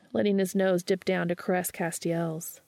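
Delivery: ordinary delivery, nothing marked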